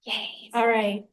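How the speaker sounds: ordinary talk, nothing marked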